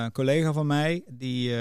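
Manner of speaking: ordinary talk, nothing marked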